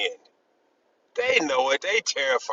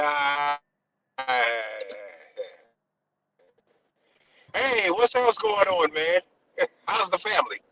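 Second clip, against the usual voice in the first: mock change of subject. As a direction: brush the question off with fake cheer. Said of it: "I'd be like".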